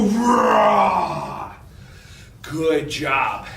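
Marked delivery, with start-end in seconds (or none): 0.0-1.5 playful shouted bear roar; big bear roar. Shown as a demonstration. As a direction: breathe in and let out a big roar. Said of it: "breathe in and go".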